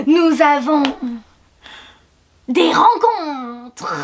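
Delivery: very slow, solemn trailer narration. Acted. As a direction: stretch each word like an epic teaser. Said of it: "voix de bande-annonce".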